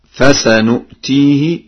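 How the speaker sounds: "فسنؤتيه" slowly, with n after fasa; with nun. Shown as a demonstration. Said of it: "بالنون هكذا".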